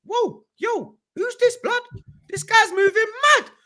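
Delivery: high whoa of awe; amazed. Retold or imitated. Telling friends about Wilshere, high stunned exclamation. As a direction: pitch leaps up, stunned.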